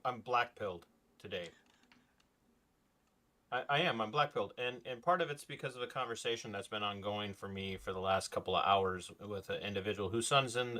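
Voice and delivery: usual speaking voice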